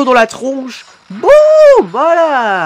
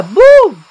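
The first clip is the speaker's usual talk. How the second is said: loud high boum like an impact; boum sound effect; 9 semitones higher, 7 dB louder than usual. Real, not acted. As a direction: shout the impact out. Punctuates a hit in video. (bruitage boum fort et aigu)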